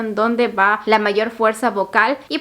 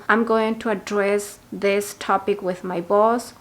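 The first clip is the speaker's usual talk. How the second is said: slow, careful model sentence; slow example sentence; slower than usual. Shown as a demonstration. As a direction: read it slowly for learners. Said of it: "pueden decir algo como". (frase de ejemplo lenta)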